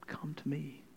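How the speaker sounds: hushed whisper of Jesus's invitation; soft whispered invitation to the weary. Acted. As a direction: breathe it like a gentle call. Jesus's words whispered in sermon.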